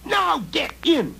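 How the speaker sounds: high, loud goblin bellow; goblin shout. Acted. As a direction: roar the order. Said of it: "he shouted".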